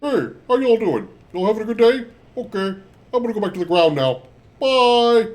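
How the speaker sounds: high, silly puppet voice greeting everyone. Pretend. Streamer playing a puppet to greet chat, high puppet voice. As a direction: play a goofy hand puppet saying hi and bye. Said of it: "I'm a puppet".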